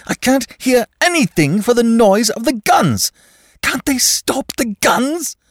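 from fear to frantic pleading; rising panic. A narrator's reading. Shell-shock episode, mounting terror.

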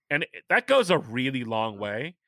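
ordinary talk

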